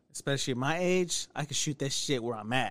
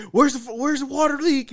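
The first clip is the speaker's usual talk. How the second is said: higher, louder mock firefighter barging in; urgent firefighter shout; 10 semitones higher, 6 dB louder than usual. Cohost imagines firefighters bursting in, a loud mock shout. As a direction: yell it like you're kicking the door in.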